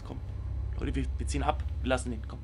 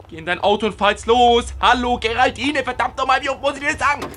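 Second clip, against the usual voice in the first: louder, higher scolding; exasperated yelling; 6 semitones higher, 5 dB louder than usual. Real, not acted. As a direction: scold her like a fed-up friend. Yelling at Geraldine in video. (genervt schimpfend, laut)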